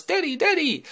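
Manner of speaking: put-on very high girlish call; teenage daughter. Athena bursts in on Zeus, high teenage-daughter voice. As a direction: burst in calling for Daddy in a squeaky voice. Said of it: "teenage daughter Athena bursts in".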